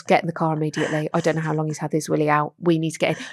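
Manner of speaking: low, fast, quiet urgent order; fast low hushed command to husband. Retold or imitated. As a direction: hurry him quietly out of earshot. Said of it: "And I said to Chris".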